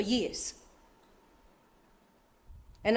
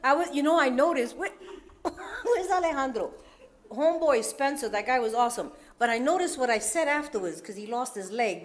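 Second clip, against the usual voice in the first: ordinary talk, nothing marked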